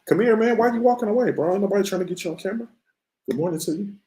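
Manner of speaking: father teasing his shy son in a high, fast, playful voice; playful high coaxing. Real, not acted. Dad coaxes son onto camera, playful high voice. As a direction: coax him over, playful and bright.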